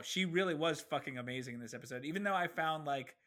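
ordinary talk, nothing marked

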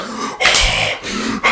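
quiet breathy gasp; imitated shocked gasp; mock gasp. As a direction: suck in air like a shocked German. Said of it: "he was like".

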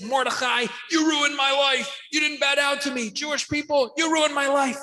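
high-pitched, hammed-up villain's complaint; Haman. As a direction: wail the accusation in mock outrage. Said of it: "Haman comes and he says".

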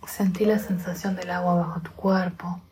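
soft, unhurried meditation voice with long pauses; guided meditation. Acted.

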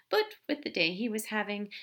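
plain reading voice

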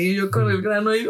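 ordinary delivery

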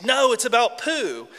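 high, loud squeak of protest; squeaky little contradicting voice; Piglet. Acted. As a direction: squeak back like a small jealous creature. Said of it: "Piglet squeaks".

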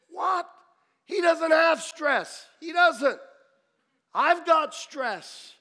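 loud, high protest; slow, emphatic exasperated shouting; incredulous outburst. Retold or imitated. Retold outraged protest at God's instruction.

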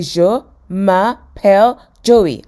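slow, loud word-by-word repetition; piece by piece. Acted. Joey copying Phoebe's French bit by bit, slowly. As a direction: echo each syllable separately, carefully. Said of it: "when he tried to put it together".

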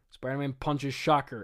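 ordinary talk

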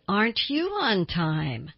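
slow, lilting sarcasm; mock praise for a latecomer; sarcastic. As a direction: stretch it out with a mocking lilt. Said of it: "saying it in that sarcastic".

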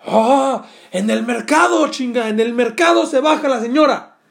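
loud high yell; shout. Retold or imitated. Angry guy yelling to the combi driver about the lady's stop, loud shout. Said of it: "el güey grita".

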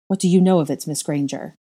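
usual speaking voice

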